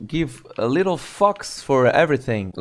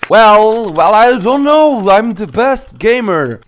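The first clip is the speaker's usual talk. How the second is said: high, loud hammed-up bragging; mock boast; 9 semitones higher, 10 dB louder, slower than usual. Gamer gloating after unlocking the door, hammed-up brag. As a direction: gloat like a champion.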